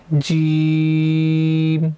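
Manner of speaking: جیم drawn out very slowly; stretched jeem. Shown as a demonstration. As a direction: hold the long ee in جیم. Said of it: "اس کو کھینچ کے". (جیم کو کھینچ کر)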